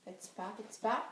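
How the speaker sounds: soft, low, careful voice; hushed storytelling, creeping along; tiptoeing hush. Acted. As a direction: say it softly as if tiptoeing. Tiptoeing past danger in story.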